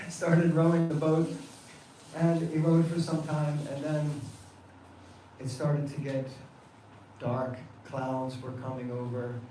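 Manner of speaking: plain reading voice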